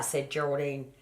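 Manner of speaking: usual speaking voice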